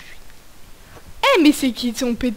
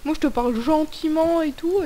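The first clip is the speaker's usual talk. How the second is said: normal talking voice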